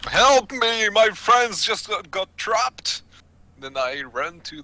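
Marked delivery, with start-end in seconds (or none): 0.1-3.0 high, loud plea to the dragon; desperate yell for help. Acted. Adventurer begs the dragon for trapped friends, desperate yell. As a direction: yell out urgently for rescue. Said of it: "I yell at the dragon".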